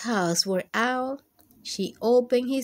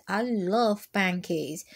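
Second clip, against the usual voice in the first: ordinary delivery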